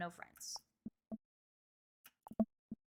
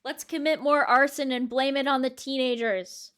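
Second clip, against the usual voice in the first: louder, slower deadpan proclamation; loud joke read; 3 semitones higher, 11 dB louder than usual. Acted. Player reading the silly dad choice aloud, loud and slow. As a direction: announce the absurd option loudly.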